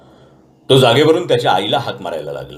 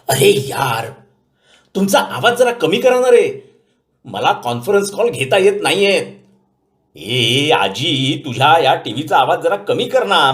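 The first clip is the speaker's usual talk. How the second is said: pleading scold, higher than the narrator's voice; 6 semitones higher, slower than usual. A narrator's reading. Grandson scolding the family to keep noise down. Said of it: "सगळ्यांवर डाफरला".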